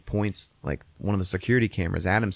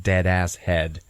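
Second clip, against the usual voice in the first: ordinary delivery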